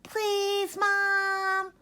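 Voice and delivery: put-on high, loud childlike plea; pleading little ones. A narrator's reading. Sheba and Sam begging their mom to let them play, high and loud. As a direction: beg like two excited kids. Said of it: "cried Sheba and Sam".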